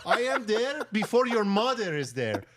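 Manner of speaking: lightly voiced Persian salesman, high and eager; a hint of a Persian accent, pledging devotion. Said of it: "He's just like".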